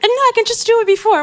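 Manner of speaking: high, light imitation of the guest brushing it off; mock guest voice. Host imitating guest insisting she can do it first, high mock voice. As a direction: lightly mimic her saying she can squeeze it in. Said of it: "and you're like".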